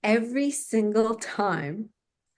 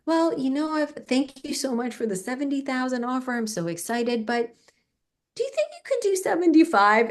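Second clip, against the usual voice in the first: nervous ask; 6 semitones higher than usual. Retold or imitated. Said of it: "Trembling, trembling with my eyes closed".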